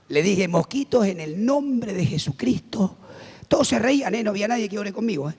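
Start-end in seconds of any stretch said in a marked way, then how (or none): 0.5-3.0 slow, drawn-out solemn address; solemn authority. Retold or imitated. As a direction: pronounce it like a weighty decree. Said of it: "ya tenía la autoridad".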